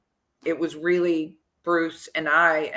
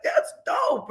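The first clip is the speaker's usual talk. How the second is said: impressed. Retold or imitated. Player impressed by photo, retold.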